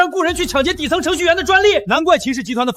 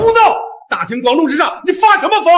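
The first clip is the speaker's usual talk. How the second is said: angry rebuke. Acted. Elder rebukes a public outburst.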